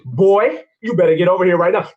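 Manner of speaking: a mother losing patience; stern mama. Acted. Mother scolds fleeing son.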